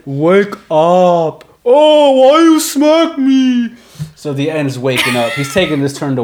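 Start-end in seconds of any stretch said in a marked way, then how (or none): 0.0-1.4 dopey giant. Acted. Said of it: "he smacks the other head". 1.6-3.7 much higher, louder, very slow complaining voice; hurt, slow-witted protest; whiny dumb giant. Acted. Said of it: "he smacks the other head".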